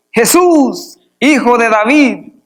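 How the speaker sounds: high, drawn-out acted call; crying out. Preacher reading blind Bartimeo's plea to Jesus, crying out. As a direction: call out as if over a crowd. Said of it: "comenzó a dar voces".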